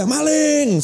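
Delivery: high-pitched cry of thief; mock yell. Telling a debtor to yell thief, mock alarm cry. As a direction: raise the alarm with one high call. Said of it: "teriak saja".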